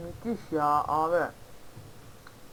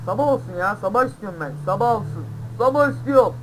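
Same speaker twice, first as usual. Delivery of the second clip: loud pleading; 4 semitones higher, 7 dB louder than usual. Real, not acted. Longing cry for morning while riding.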